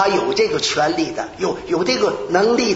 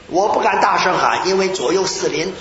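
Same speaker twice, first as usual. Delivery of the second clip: ordinary talk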